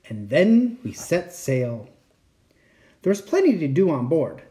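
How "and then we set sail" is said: usual speaking voice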